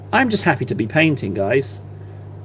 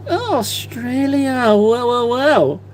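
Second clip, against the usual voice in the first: mock musing; 8 semitones higher, much slower than usual. Amused reaction to a viewer.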